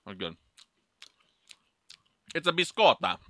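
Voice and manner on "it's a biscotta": higher-pitched stage Italian; mock Italian accent. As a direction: announce the treat in put-on Italian. Playing an Italian while tasting.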